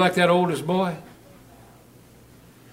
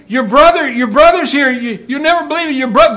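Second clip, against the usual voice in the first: loud, much higher, breathless news; excited servant; 9 semitones higher, 8 dB louder than usual. Acted. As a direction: blurt it out, thrilled.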